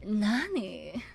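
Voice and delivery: sultry teasing Nani?; drawn-out coy older sister Nani?; ara ara older sister voice. Shown as a demonstration. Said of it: "with, like".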